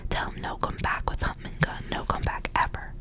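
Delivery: ordinary talk, nothing marked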